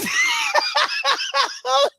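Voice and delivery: real high staccato cackle; high cackling laugh. Real, not acted. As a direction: burst out laughing mid-sentence. Cracks up at own imitation.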